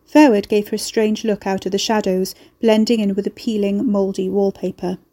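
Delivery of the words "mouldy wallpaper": usual speaking voice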